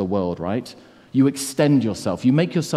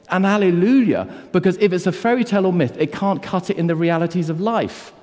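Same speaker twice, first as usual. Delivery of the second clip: higher, forceful sermon build; preaching; 6 semitones higher than usual. Acted. A preacher arguing to the congregation, impassioned. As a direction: press the point home with fire.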